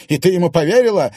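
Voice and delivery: loud, fast indignant question; angry disbelief. A narrator's reading. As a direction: fire it back fast. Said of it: "вспылил Платон". (возмущённо и быстро)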